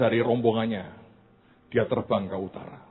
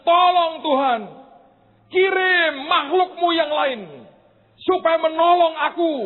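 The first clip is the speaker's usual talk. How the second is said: shouting to God; 7 dB louder than usual. Acted. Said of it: "Dia teriak".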